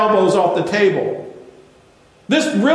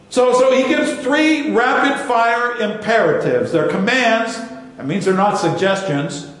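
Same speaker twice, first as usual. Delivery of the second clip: ordinary delivery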